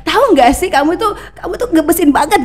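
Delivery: high, gushy teasing intimacy; chatting to a sweetheart. Acted. Host treating the mic as a partner, high playful girlfriend chatter. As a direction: talk to the mic as your sweetheart. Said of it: "cara kau ngomong sama pacar kamu".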